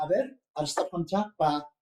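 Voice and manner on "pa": "pa" as one stop burst that cannot be held; non-continuous sound. Shown as a demonstration. Teacher contrasting it with continuous sounds, stopped release. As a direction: release "pa" from closed lips. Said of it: "A comparación del sonido pa".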